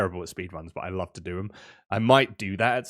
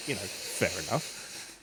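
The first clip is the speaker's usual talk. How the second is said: usual speaking voice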